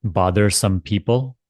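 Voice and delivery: calm spiritual guidance, spacious and gentle; guided meditation. Acted. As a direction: ease them into letting go. Leads guided surrender meditation.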